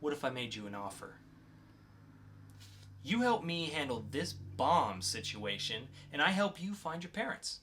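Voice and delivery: lightly voiced calm interrogator, cool and in control. Podcast host reads the mysterious agent to a captured teen, cool and controlled.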